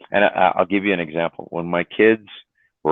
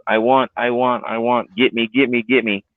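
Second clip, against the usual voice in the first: higher put-on begging, lightly mocked; mocking the kids' begging; 5 semitones higher than usual. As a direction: parrot the kids' begging back a bit higher. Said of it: "And I said".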